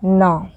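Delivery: न said as a short clear na; tongue up, say न; न as in नाम; na sound. Shown as a demonstration. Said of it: "जिसका pronunciation होता है".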